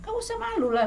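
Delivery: very high, coaxing retort; playful urging. Retold or imitated. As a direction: brush away their shyness in a lifted voice. Retells her urging the shy singer.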